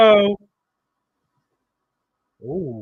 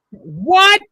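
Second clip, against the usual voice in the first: high, loud disbelieving cry; shocked shout; 11 semitones higher, 7 dB louder than usual. Real, not acted. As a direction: yell it in sudden disbelief. Shocked by a wrestling finish.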